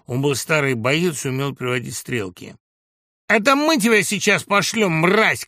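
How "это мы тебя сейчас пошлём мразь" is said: high, loud threat; abusive shout. A narrator's reading. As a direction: snarl back at the old man at full voice. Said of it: "крикнул Бобров". (злобный крик)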